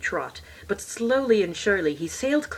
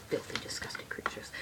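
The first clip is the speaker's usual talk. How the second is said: sneering whisper; 16 dB quieter than usual. Pretend. Sneering aside.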